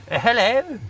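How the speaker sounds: a quick high, loud posh English hello; posh English accent. Shown as a demonstration. Vlogger mocking a posh English politician, posh English accent. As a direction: toss off one word in a posh public school English accent. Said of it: "He had that very posh English public school boy ... accent".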